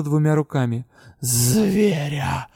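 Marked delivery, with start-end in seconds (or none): 1.2-2.5 drawn-out hissed word; hissing. A narrator's reading. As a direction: hiss the word menacingly. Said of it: "зашипела она". (зашипела Дара)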